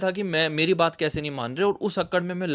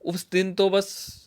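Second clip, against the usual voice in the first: ordinary talk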